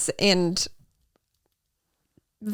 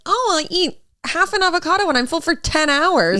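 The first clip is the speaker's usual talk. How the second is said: high, airy voice mocking a health nut; prissy know-it-all impression; mocking healthy people; 8 semitones higher than usual. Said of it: "they're like".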